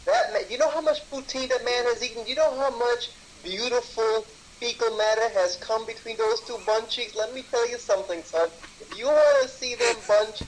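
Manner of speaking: lightly put-on hustler patter; mock haggler. Co-host haggling a price for the picture, hammed-up pitch. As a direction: raise the pitch and sell it hard.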